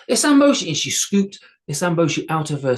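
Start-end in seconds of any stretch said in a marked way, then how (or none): 0.1-0.7 high, loud call for help; girl calling out. A narrator's reading. Girl calling her tiny guard for help, loud high call. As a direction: shout the name up high. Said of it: "girl calls for Isamboshi".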